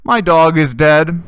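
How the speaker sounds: loud, slow mock announcement; blurted retort. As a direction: imitate a blunt, stretched-out reply. Retold blunt reply to small talk.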